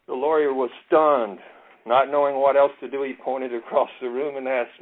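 ordinary talk, nothing marked

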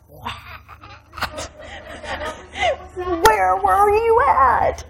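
imitating amazed women, hushed then high; breathy "what", then a drawn-out, high-pitched question; mock astonishment. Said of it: "all the women were like".

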